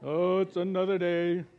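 lightly voiced gloomy donkey, a laugh in it; a hint of glum Eeyore moaning. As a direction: sigh it out like a mopey Eeyore. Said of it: "You remember Eeyore?".

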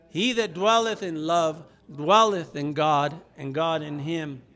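loud, slow, solemn Bible reading; scripture reading. Acted. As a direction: proclaim the verses aloud to the church. Reads scripture aloud with congregation.

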